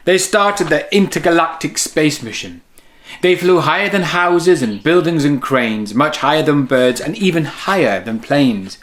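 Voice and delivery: lively storybook read-aloud; reading to children. A narrator's reading. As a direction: read it like bedtime story time for kids. Reads picture book to children.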